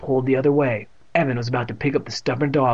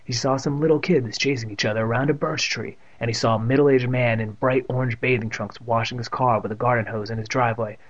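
usual speaking voice

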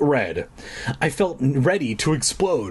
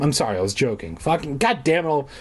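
ordinary talk

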